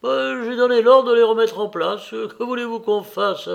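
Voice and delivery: high, loud protest; despairing steward pleading his case; exasperated, helpless steward. A narrator's reading. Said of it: "répondit l'intendant en faisant un geste désespéré".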